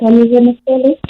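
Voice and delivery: faint far-off phone voice; phone held away. Real, not acted. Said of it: "[name], talk into the phone".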